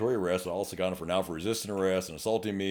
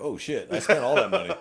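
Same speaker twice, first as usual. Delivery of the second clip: laughing mock dismay; 7 semitones higher, 4 dB louder than usual. Reacting to tax bill.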